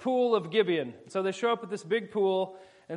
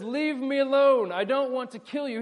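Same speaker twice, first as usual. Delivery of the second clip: calling out; 7 semitones higher, 5 dB louder than usual. Retold or imitated. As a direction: call out to back him off. Fleeing man calling back.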